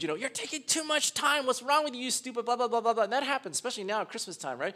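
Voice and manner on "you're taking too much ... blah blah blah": mock rude shopper. Preacher mimics a rude stranger at the mall, mock angry rant. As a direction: play the rude stranger snapping at you. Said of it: "somebody's really rude".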